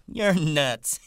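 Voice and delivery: very high, loud amused woman; laughing teasing. A narrator's reading. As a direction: tease with a laugh in your voice. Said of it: "Curly's wife laughed at him".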